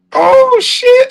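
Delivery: sudden high "oh" of surprise; surprised. Real, not acted. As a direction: caught off guard, exclaiming. Surprised at being monetized.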